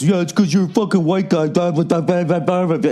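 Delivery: high, whiny mock voice; mocking critic. Mimicking people giving him shit online, high and whiny. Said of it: "Then, of course".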